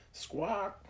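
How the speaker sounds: high shrill creature screech; bat squawk. A narrator's reading. Tiger bat cry.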